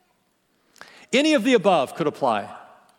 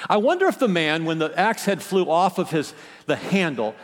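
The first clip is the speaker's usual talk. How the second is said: ordinary talk, nothing marked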